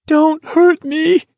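very high, drawn-out scream of pleading; slow, piercing, panicked plea; terrified scream. A narrator's reading. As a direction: scream in terror, begging for mercy. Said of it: "someone screamed".

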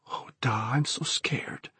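soft, trembling whispered lament; scared whisper. A narrator's reading. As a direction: whisper it mournfully in fear. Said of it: "he mourned".

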